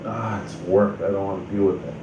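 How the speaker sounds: ordinary talk, nothing marked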